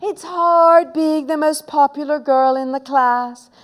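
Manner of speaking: high, loud, drawn-out boast; braying. A narrator's reading. Moody girl boasting of her popularity next door, piercing bray. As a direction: bray it smugly, stretching the words. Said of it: "brayed moody Margaret".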